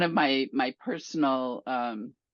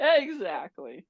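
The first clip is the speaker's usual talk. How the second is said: laughing; 2 semitones higher, slower than usual. Real, not acted. Joking about being bought.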